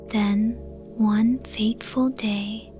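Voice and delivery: ordinary delivery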